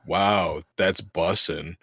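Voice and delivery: deadpan, unimpressed slang; without any enthusiasm. Retold or imitated. Teasing the kids at work, deliberately unenthusiastic. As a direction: say it with zero enthusiasm. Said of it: "is without any enthusiasm".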